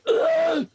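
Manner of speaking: very high, strangled shriek; distant scream. Acted. Game master voices something screaming down below, high shriek. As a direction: let out a short high-pitched scream. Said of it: "scream from something down below".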